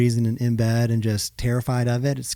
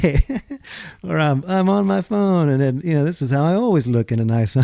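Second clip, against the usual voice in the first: higher, airy pretend-casual poser; mock posed model; 4 semitones higher than usual. Husband mocking his own staged lifestyle photos. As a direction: ham up the perfect lifestyle shot.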